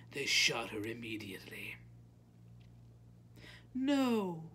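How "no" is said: high-pitched dismayed protest; distressed listener interrupting. A narrator's reading. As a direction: object in a high voice.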